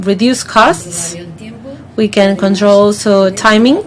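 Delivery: ordinary talk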